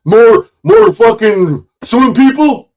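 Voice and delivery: high, loud, slow sneering caricature; high, slow mock voice of a rival. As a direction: parody them with a squeaky put-on voice. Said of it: "I could say".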